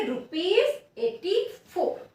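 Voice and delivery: "Rupees एटी four" read slowly, stopping after four with no पैसे; teacher demonstrating the right way to read the amount; correct reading, slow. Shown as a demonstration. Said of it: "इसको कैसे पढ़ेंगे?".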